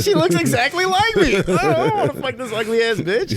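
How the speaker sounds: loud, high mock disgust at his own face. Talking to a lookalike date, loud mock disgust. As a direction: sneer at the mirror, laughing. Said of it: "I'll be like".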